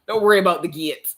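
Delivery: light Jamaican grandma reassuring her about the gate. Retold or imitated. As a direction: impersonate grandma calming you down. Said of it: "my grandma ... is just like".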